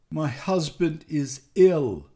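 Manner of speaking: slow, mildly acted sob; sobbing. A narrator's reading. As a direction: sob it out slowly, understated. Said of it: "she sobbed out".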